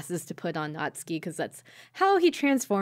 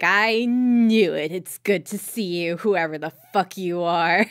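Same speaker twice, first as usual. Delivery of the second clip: smirking; 5 dB louder than usual. Retold or imitated. Nagi unmasking the evil twin, mock smug. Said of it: "smirks, and he's like".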